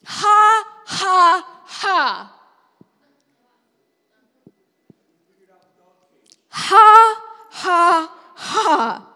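loud, high, deliberate ha ha; dry laughter. Shown as a demonstration. Preacher leading the congregation in forced laughter, loud. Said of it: "That's how you can begin laughing".

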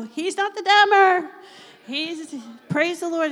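loud, high called-out comeback; imitating a brash, shouted correction; bold retort. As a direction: belt it out like an exuberant rebuttal. Acting out his bold retort.

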